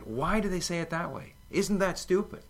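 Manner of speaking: lightly acted exasperated student; mock indignant complaint. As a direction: mimic fed-up students griping. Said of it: "These guys were saying".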